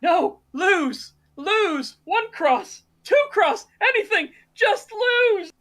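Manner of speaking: hammed-up desperation, word by word; frantic mock pleading. Quark runs over to beg the gamblers, mock frantic.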